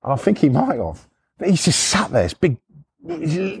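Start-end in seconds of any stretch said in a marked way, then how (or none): none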